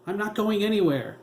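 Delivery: high, loud defiant yell; young son's yell refusing to leave; boy yelling. A narrator's reading. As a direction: shout it back at his mother. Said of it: "he heard Teddy yell".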